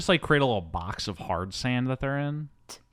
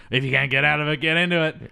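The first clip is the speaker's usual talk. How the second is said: raised, climbing voice for a retold retort; mock defiance; 5 semitones higher, 4 dB louder, faster than usual. Retelling her retort before she goes with Emotep, mock defiant. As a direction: throw it out as a rising challenge. Acted quote of defiant heroine.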